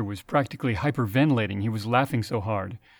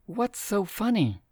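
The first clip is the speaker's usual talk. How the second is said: higher and slow, wary question; ask, half not wanting the answer; hesitant, afraid; 10 semitones higher, slower than usual. Acted. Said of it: "afraid to hear the answer".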